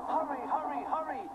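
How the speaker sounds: panting. Acted. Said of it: "he panted to the cars".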